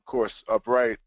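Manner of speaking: ordinary talk, nothing marked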